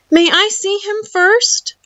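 put-on high-pitched boy's polite plea; eager boy. A narrator's reading. Boy eagerly asks to see robot.

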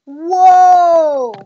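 loud surprised whoa. Real, not acted. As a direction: gasp it out loud. Surprised at mirror effect.

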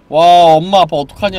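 loud dismayed wow; loud worried outburst; alarmed exclaim. Real, not acted. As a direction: blurt it out in dismay. Reacting to scary parents.

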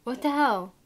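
appalled outburst; outraged. Real, not acted. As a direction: demand an answer, appalled. Outraged reaction.

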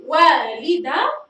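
والده said slowly with a rise and a clearly voiced end; spelled-out word. Shown as a demonstration. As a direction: draw out والده for the class. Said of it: "أنا أريد أن أنطق الهاء الآن". (نطق والده ببطء ووضوح)

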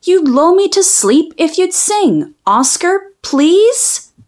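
ordinary delivery

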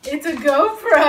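real excited realisation; delighted surprise. Real, not acted. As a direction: burst out with the happy discovery. Discovers the gift is a GoPro.

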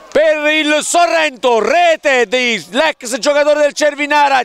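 breathless italian goal commentary. Acted. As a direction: shout the goal to the crowd. Goal calls at the end. (telecronaca del gol senza fiato)

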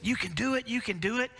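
high cheerful encouragement; cheering on. Retold or imitated. Father cheering on his kids, upbeat. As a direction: pump them up. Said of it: "encouraging children".

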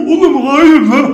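ordinary delivery

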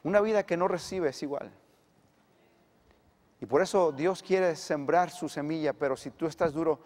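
ordinary delivery

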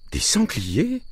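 high, anxious question; anxious high-voiced disbelief; worried mother. A narrator's reading. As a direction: ask it in alarm, pitched right up. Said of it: "dit ma mère inquiète".

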